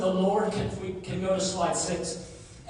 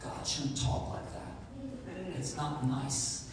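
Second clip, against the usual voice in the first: mock prim aside; 4 semitones lower, 8 dB quieter, slower than usual.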